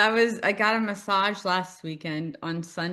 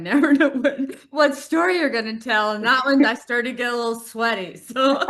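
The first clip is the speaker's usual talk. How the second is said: high, loud, laughing through the words; 6 semitones higher, 8 dB louder than usual. Real, not acted. Cohost laughing at the Twinkie story, loud and high. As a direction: talk while cracking up.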